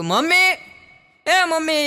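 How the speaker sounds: high, loud sing-song call of Mummy; a new bride calling sweetly up to her mother-in-law. Retold or imitated. A daughter-in-law calling her mother-in-law, in a high loud sing-song call.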